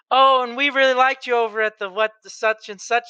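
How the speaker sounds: high, coy put-on Southern belle; pretend lady of the night. Girls pranking uncle's voicemail, mock Southern belle. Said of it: "the Southern Belle".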